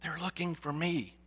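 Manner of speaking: put-on soft, hushed mischievous child; whispering, muffled giggle. A narrator's reading. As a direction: whisper it like a child's gleeful secret. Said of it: "Still whispering, the young voice replied".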